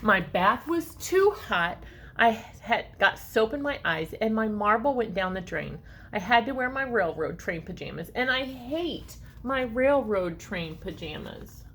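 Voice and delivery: put-on lively read-aloud for children; story narration. A narrator's reading. Reading a story to children, lively and expressive. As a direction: read it like a picture book to kids. Reads picture book aloud.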